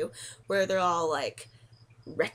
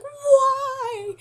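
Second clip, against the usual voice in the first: much higher, louder anguished cry; anguished yell; 10 dB louder than usual. Retold or imitated. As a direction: wail at the falling Yoshi. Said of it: "I'm like".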